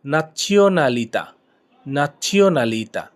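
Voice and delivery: "nazionalità" drawn out very slowly; teacher modelling "nazionalità" syllable by syllable; slow pronunciation demo. Shown as a demonstration. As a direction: stretch every syllable for the learner. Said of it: "জাতীয়তা এর Italian হলো".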